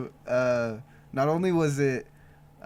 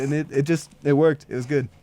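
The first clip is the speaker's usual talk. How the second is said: ordinary delivery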